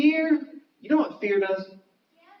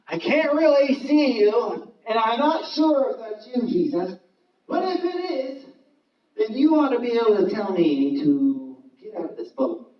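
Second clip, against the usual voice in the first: doubtful Peter; 6 semitones higher than usual. Acted. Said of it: "He's like".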